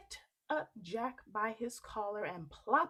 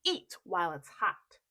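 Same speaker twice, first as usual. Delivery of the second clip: louder brushing-off; dismissive giantess; 3 semitones lower, 7 dB louder than usual. Acted. Wife fobbing off the giant, louder. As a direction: distract him with breakfast. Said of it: "his wife said".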